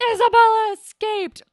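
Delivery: loud, high mock-alarmed announcement; retold alarmed cry. Student tells teacher the guinea pig is out, alarmed. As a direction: blurt it loudly, hamming up the alarm.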